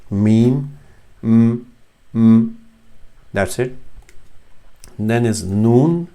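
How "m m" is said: m hummed alone on closed lips; lip-made m. Shown as a demonstration. Teacher showing the lip sound, hummed isolated m. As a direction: press the lips and hum each m separately. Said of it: "pronounced with the lips".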